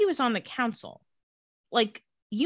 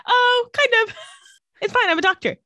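high, loud, airy brush-off; a much higher, louder, casual voice; breezy mock doctor; 14 semitones higher, 4 dB louder than usual. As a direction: wave it away like it's nothing. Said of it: "and she's like".

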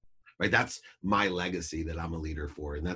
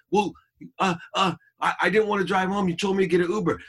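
high, loud stammering protest; imitated cornered teen; stumble, then plead your case high; flustered defence; 10 semitones higher, 5 dB louder than usual. Acted quarrel, daughter stammers defence.

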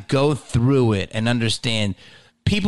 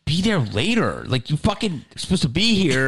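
incredulous retold comeback, pitched up; indignant echo; 4 semitones higher than usual. Comic retells scolding his late writing partner, indignant. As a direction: throw the excuse back in disbelief. Throwing the excuse back, indignant.

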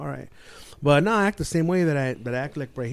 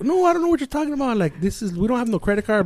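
high mock woman's voice; hurried, high-pitched female imitation; protesting wife impression; 9 semitones higher than usual. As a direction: deny it as the flustered wife. Wife denies knowing of debt.

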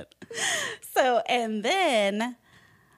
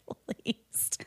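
dry whispered remark, much softer and lower; whispered aside; 7 semitones lower, 6 dB quieter, slower than usual. Real, not acted. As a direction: whisper it to yourself. Aside under breath.

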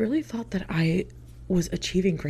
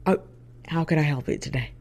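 put-on thick Southern accent; 2 semitones lower than usual. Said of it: "with a thick Southern accent".

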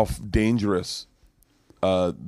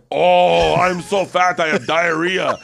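put-on high, loud foreign-accented English; Kazumi's broken-English accent; 7 semitones higher, 5 dB louder, much slower than usual. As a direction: mimic a non-native actor wailing a garbled line. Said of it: "then he'd be like".

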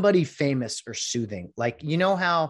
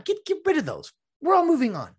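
exasperated; 14 semitones higher, 4 dB louder than usual. Real, not acted. Exasperated rant about bubbles.

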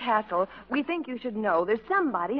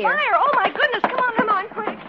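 high-pitched urgent alarm; acted fire panic; panicked; 9 semitones higher, 3 dB louder than usual. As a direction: hurry everyone out in a fluster. Fleeing a supposed fire.

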